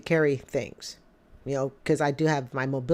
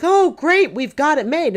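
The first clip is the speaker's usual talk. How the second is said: much higher and louder, gushing; mock delight; 9 semitones higher, 5 dB louder than usual. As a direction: play the giddy couple thrilled at a raise. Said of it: "They'll be like".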